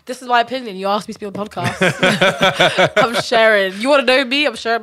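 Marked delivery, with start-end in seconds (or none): 1.4-4.8 loud, laughing protest. Real, not acted. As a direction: laugh it out defiantly. Defending her opinion, laughing.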